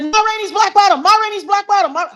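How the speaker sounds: high, loud repeated scream of the title. Retold or imitated. Mother screaming the play title while mopping, loud and high. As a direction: belt the title out at the top of the voice. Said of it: "I screamed it".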